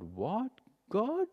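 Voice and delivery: questioning, leaping very high on the last word; startled disbelief. Pretend. Prophet stopped by God as he leaves, startled disbelief. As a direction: play a baffled double take, voice shooting up.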